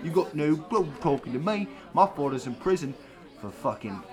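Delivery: swagger like a pub geezer telling a tale; hammed-up Cockney. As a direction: Oliver the Londoner telling a pub story. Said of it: "Hey, it's me, Oliver".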